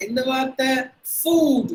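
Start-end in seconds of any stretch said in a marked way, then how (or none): 1.2-1.7 food with a long oo; long vowel. Shown as a demonstration. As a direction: stretch food out, high and loud. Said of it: "We have to say with a long sound".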